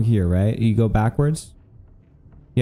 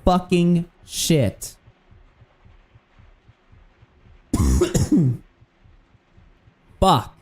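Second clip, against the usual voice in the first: shaken relief; 5 semitones higher, much slower than usual. Real, not acted. After escaping the monster.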